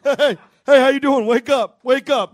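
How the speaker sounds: loud, high mock nudging shout; a hammed-up yell of wake up; imitating a brash stranger rousing a sleeper; loud guy waking someone. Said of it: "he's literally next to her going like this".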